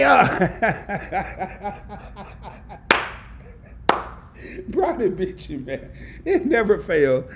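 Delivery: talking through laughter; laughing. Real, not acted. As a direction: chuckle while you speak. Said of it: "you got me over here laughing".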